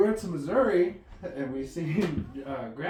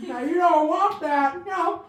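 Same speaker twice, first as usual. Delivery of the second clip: Mudbone impression; 8 semitones higher, 5 dB louder than usual. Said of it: "My dad does talk like".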